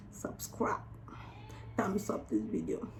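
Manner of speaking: whispered aside. Real, not acted. Vlogger whispers the subscribe request to viewers. Outro plea.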